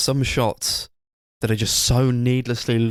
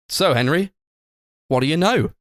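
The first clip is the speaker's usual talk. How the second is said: higher-pitched, chirpy questioning dad voice; upbeat smiley dad; 6 semitones higher than usual. Retold or imitated. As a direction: imitate a relentlessly cheery father making small talk. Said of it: "upbeat and smiley".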